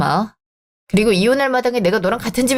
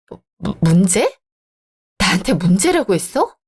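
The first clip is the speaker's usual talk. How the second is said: stammering disbelief; 3 semitones lower than usual. Acted. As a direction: start low and stammer, voice leaping up at the end. Stunned at being called the problem. (어이없어 더듬으며 되묻기)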